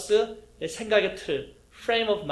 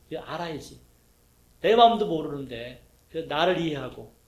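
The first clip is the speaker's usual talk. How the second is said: usual speaking voice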